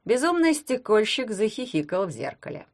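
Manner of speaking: plain reading voice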